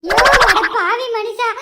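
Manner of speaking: loud, slow lamenting cry; loud wail. Acted. Wife wailing at her husband in the hotel, loud and slow. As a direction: bawl it out slowly.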